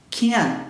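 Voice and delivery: "can't" stopped on an unreleased T; held T. Shown as a demonstration. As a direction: trap the final T of "can't". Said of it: "Eu seguro o T".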